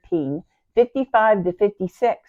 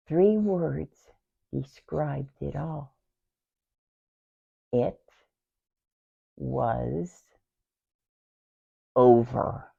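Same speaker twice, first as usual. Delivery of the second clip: low, soft words with long pauses; bleak despair; 3 semitones lower, 6 dB quieter, slower than usual. Acted. Sister declares it all over, bleak despair. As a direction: let each word fall separately, defeated.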